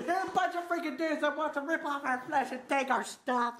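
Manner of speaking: loud, high-pitched blunt explanation; exasperated outburst. Acted. Blunt answer to "In English?.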